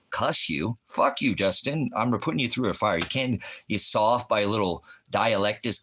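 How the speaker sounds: ordinary delivery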